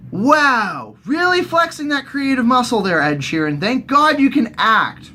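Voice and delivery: high, mock-impressed gushing; sarcastic praise. Real, not acted. Mocking [name]'s acting, high sarcastic praise.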